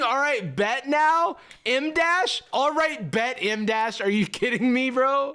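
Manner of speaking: very high, squeaky disbelief; high-pitched comic outrage; incredulous outburst. Real, not acted. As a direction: exclaim it way up high, can't believe it. Incredulous at AI slang.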